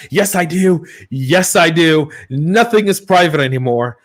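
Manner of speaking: high, loud mock-triumphant retort; playful comeback. Host teasing an anonymous commenter, loud playful comeback. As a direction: answer back high and emphatic, stretching the repeat.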